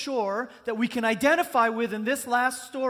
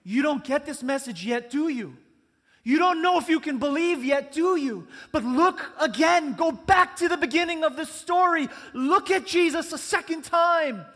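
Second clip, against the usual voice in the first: louder, higher and pleading; rising preacher's plea, louder and higher; voicing Mark; 6 semitones higher, 8 dB louder than usual. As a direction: preach Mark's challenge out loud. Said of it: "Mark is saying".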